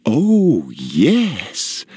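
high swooning delight on the first word; delighted matron; enthusiastic self-admiration. A narrator's reading. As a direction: admire yourself with relish. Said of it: "enthusiastically admired her new self".

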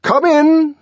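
high, loud command. A narrator's reading. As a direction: call the door open with authority. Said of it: "he commanded".

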